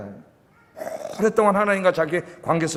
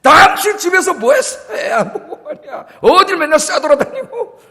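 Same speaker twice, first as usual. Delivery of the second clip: much higher, louder, slow angry scolding; angry husband; 12 semitones higher, 6 dB louder than usual. Retold or imitated. Husband at the hospital blaming his wife, angry shouting. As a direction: blast the wife for not watching the kid. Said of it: "화를 내면서".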